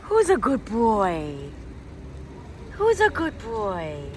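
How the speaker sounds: slow, singsong praise for a dog; cooing at a little dog she found; sweet dog talk. Real, not acted. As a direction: coo it at the puppy.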